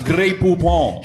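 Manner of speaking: slow, loud fake French; fake French accent. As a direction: drawl it like a hammy Frenchman.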